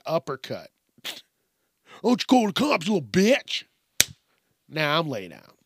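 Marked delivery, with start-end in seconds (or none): 2.0-3.6 high, taunting mock threat; mock aggressive fighter. Fighter turning on the bystander, mock aggression. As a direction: snap back as an angry brawler.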